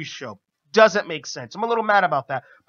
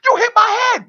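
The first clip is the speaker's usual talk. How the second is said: much higher, loud angry yell at the ref; retold scream; 8 dB louder than usual. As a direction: blast the blame at the referee. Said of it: "screams at him".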